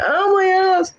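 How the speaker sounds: very high mock teen whine; complaining daughter. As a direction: imitate the slapped daughter in a squeaky high voice.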